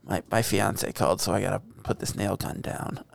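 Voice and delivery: hushed, breathy whisper. Retold or imitated. Friend whispers Bateman's nail gun line, whispered. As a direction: whisper it like a secret. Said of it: "and he's like".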